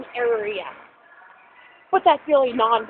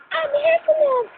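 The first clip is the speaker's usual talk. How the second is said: high silly cartoon voice; cartoon character voice; 12 semitones higher, slower than usual. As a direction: play a silly cartoon creature. Imitating Heffalump character.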